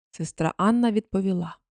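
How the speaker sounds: ordinary delivery, nothing marked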